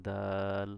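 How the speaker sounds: দাল with a long, level vowel; drawn-out letter name. Shown as a demonstration. Teacher recalling the letter learned earlier, দাল drawn out. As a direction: hold the middle of দাল steadily.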